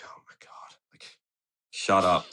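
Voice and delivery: breathy whispered oh my God; whispered annoyance. Retold or imitated. Skater fed up with thumb questions, whispering. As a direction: mutter it under your breath, fed up. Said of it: "I was just like".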